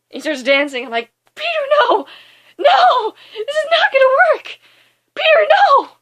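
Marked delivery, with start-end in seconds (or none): 1.3-5.9 high, loud mock-panicked yelling; exasperated shout. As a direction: shout it like pleading at a screen. Her shouted protest at the dancing.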